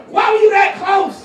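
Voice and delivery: loud, high incredulous yell; exasperated shout. Acted. Comic scolding hikers over straying near Iran, exasperated shout. Mock outrage at hikers' excuse.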